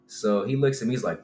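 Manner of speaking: usual speaking voice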